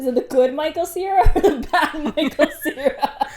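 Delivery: real laughter breaking into the words; amused, teasing question dissolving into laughs; laughing while asking. Real, not acted. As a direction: giggle through the question.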